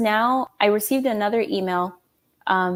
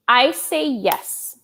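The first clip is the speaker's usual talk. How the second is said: ordinary delivery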